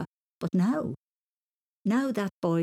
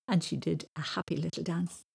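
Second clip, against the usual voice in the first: ordinary delivery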